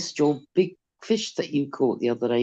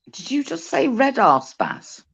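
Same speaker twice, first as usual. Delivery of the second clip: teasing surprise; 8 semitones higher than usual. Real, not acted. Mishearing fish name, teasing.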